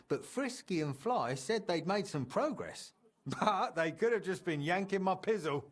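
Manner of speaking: chuckling through the words, amused; laughing. Acted. Friend laughs, doubting Frisky and Fly's clock claims. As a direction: laugh it off as a tall tale.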